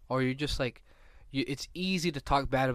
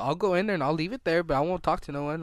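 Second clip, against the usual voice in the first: louder, higher, bratty refusal; defiant kid; 4 semitones higher, 6 dB louder than usual. Retold or imitated. Kid refusing his mom, a mock-defiant louder retort. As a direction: dig in your heels like a stubborn kid.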